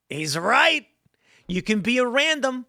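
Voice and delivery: mock realization with rising pitch; emphatic agreement. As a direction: imitate a crowd suddenly conceding the point. Voicing amused crowd agreeing.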